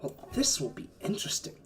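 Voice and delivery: soft self-directed grumble; muttering to himself. Acted. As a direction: mutter it privately under your breath. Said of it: "kind of mutter himself".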